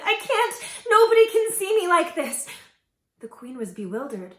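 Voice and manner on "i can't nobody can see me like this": high, loud desperate cry; distraught shout. A narrator's reading. Princess crying out from the closet. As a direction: shout it out in despair.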